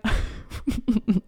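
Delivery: short amused chuckle; quick real laugh. Real, not acted. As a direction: laugh lightly at the memory. Laughing at own story.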